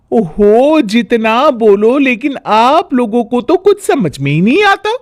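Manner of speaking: high, exasperated complaining voice; nagging bahu. Acted. Daughter-in-law scolding the family, exasperated. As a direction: sigh it out at the whole family.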